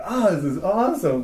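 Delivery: high, loud thrilled reaction; imitated excited listener. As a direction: act out a fan blown away by the sound. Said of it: "You're like".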